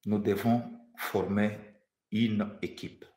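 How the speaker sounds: usual speaking voice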